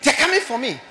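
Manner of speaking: loud, high terrified wail; frightened cry. Retold or imitated. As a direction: cry out as if spirits come for you. Dying man's cry voiced.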